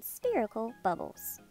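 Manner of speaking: usual speaking voice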